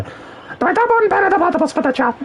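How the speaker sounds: high raspy cartoon scientist voice, far above his own; Doofenshmirtz voice. Streamer trying out his cartoon villain impression, high and raspy. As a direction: ham up a zany cartoon villain. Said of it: "do I do my Doofenshmirtz impression".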